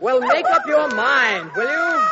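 high, loud exasperated outburst; fed-up yell. Acted. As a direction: snap loudly over the din, pitch shooting up. King exasperated by laughing, crying women.

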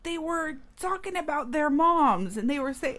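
high-pitched imitation of an indignant girl; mock upset. Girl explaining jokes about moms, indignant. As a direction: explain it like an offended child.

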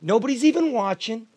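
loud, high, exasperated ranting; heated rant about missing supplies; indignant rant. Real, not acted. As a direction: vent the outrage at full voice.

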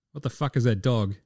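usual speaking voice

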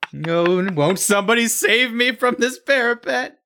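high, pleading damsel in a tower; fluttering cries for help. Pretend. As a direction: plead for rescue like Rapunzel. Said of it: "It's like Rapunzel".

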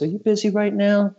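very high-pitched imitated phone caller. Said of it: "So he goes".

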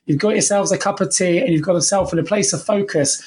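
ordinary delivery

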